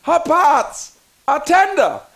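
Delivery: normal talking voice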